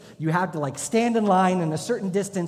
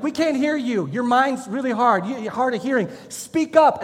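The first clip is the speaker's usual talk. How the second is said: high, loud mocking taunt; drill instructors mocking; 7 semitones higher, 6 dB louder than usual. Instructors taunting the recruit to speak up, loud mock. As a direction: taunt him to shout louder. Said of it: "And they'd be like".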